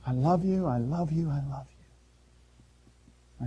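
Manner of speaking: tender whisper-soft God, dying away; fading tender repetition. Acted. God's love fading to whisper.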